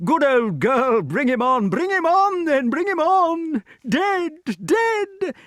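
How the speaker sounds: very high huntsman's hallooing; high-pitched whooping king; excited yelling. A narrator's reading. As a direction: urge on a hound in high delight. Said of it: "yelled King Pellinore from behind his bars".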